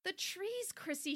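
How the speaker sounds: high, lightly acted breathlessness; panting. A narrator's reading. Mom just ran upstairs to daughter, lightly panted. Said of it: "she panted".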